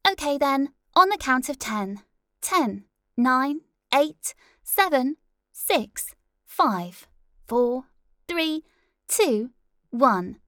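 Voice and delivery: high, loud countdown; countdown call. Acted. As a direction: count them in on air. Producer countdown.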